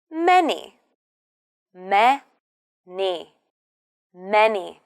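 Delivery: "Many" with the weight on "Ma"; MA-ny, first syllable strong; stress first syllable. Shown as a demonstration. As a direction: stretch "Me-ny", hit the first beat. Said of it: "The stress is on the first syllable".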